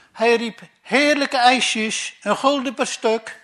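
slow, loud, high sing-song street cry; street vendor cry. Retold or imitated. Father mimics the vendor hawking ice creams, a drawn-out loud call.

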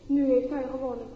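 plain reading voice

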